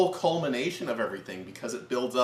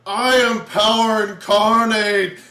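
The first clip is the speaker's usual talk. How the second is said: slow, high mock-villain shout; gloating villain; 7 semitones higher, 11 dB louder, slower than usual. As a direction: proclaim it grandly, hammed up. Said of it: "turns away from her just to go".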